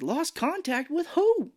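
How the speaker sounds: very high, drawn-out question; hammed-up baffled demand; mock incredulity. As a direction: squeak it in overblown disbelief. Mark's incredulous question to conductor.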